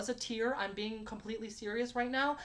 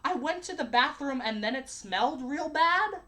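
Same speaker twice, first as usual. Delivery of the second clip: put-on slow, loud childish recital; mock four-year-old; 3 semitones higher, 5 dB louder than usual. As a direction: mimic a small kid's halting read-aloud. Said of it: "in the voice of a fucking four-year-old".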